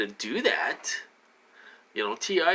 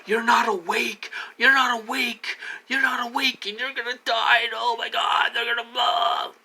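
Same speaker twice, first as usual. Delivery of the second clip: high, frantic mocking voice; mock hysterical warning, high and strained; mock panicked alarmist; 9 semitones higher than usual. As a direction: imitate a hysterical doomsayer, pitched way up. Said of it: "I mean, they're like".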